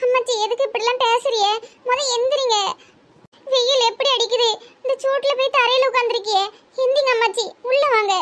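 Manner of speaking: worried. Acted. Begs grandmother to come inside. (பதற்றத்தோடு கெஞ்சும் குரல்)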